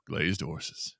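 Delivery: low, soft mock tagline; jingle tagline. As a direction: drop it low like an ad sign-off. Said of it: "sounds like a tagline to some jingle".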